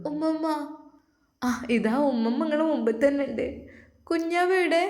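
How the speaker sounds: put-on high little girl's voice; a lightly voiced sick little girl; small child voice. Sick girl waking and calling her grandmother, high child voice.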